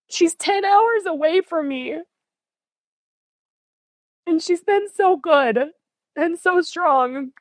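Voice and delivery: choking back tears while telling about her daughter; voice breaking. Real, not acted.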